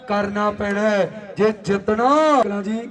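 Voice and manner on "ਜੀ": ordinary talk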